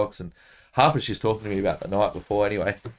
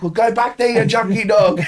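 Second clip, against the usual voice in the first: much higher, louder shouted put-down; shouted insult; 13 semitones higher, 8 dB louder than usual. Retold or imitated. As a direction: yell him out of the food court. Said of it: "I'm like".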